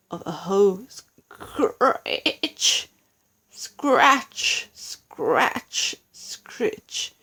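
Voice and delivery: slow, scratchy imitation of a hoe, fading to a whisper; hoe scratching noise. A narrator's reading. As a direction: scrape the words out like a hoe in soil. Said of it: "he heard the noise".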